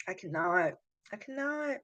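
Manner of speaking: very quiet, choked up; barely audible, fighting real tears; about to cry. Real, not acted. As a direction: shrink the voice right down, near tears. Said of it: "I'm really gonna cry now".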